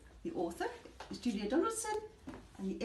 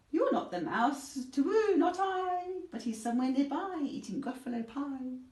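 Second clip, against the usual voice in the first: higher, hooting owl; 6 semitones higher, 6 dB louder than usual. Acted. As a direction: hoot like an owl. Owl dialogue.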